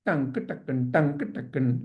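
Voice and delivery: low, soft rhythmic syllables; dance-step rhythm. Retold or imitated. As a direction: tap out the lurching dance with your voice. Imitates the dancing step rhythm.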